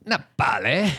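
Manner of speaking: a hint of a gruff dad; half-hearted Billy Elliot dad; northern English accent. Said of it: "his dad always says that".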